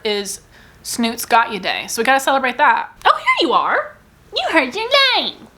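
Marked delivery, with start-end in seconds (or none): 3.1-5.5 much higher, sing-song cooing. Real, not acted. Greeting her pet Snoot, high cooing baby talk.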